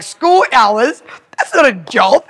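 high, loud, incredulous retort; mock outrage at the very idea; scornful disbelief. Retelling herself answering staff wanting short days, incredulous.

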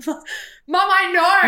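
high exasperated mock plea; exasperated cry. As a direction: plead up high and loud to mum. Friend retells coming out.

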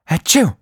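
loud comic sneeze; a sudden ticklish sneeze. A narrator's reading. She sneezed.

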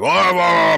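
loud, high drawn-out mock yell; mock heroic bellow. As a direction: bellow wordlessly like an over-the-top hero. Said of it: "like, the whole, like".

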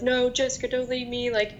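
mild mock pleading yell; Jason pleading. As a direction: imitate someone begging, without shouting. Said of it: "her and Jason yelling".

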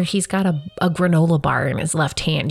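usual speaking voice

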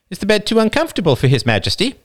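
teasing wife. Acted. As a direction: needle him as a pampered king. Wife teasing her husband.

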